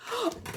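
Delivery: high, quick intake; playful surprised gasp; delighted gasp. Pretend. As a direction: gasp as if catching a kiss. Pretends to catch a kiss.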